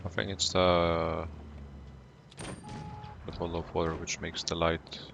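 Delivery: normal talking voice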